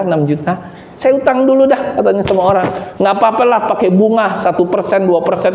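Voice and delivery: high, breezy mock of easy borrowing; mock carefree borrower. Preacher mocks a congregant borrowing against salary, high and carefree. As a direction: lampoon someone shrugging off debt. Mocking careless borrower's reasoning.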